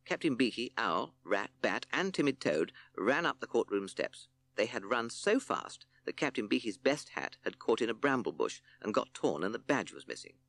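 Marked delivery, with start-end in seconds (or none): none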